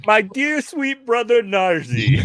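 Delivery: mock sweet greeting. Player mock greets villain.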